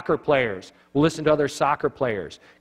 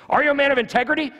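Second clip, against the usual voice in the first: high, loud, fast challenge; challenging demand; 12 semitones higher, 4 dB louder, faster than usual. Retold or imitated. Retired Marine challenging the vendor, high and loud. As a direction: fire the question at him like a drill sergeant.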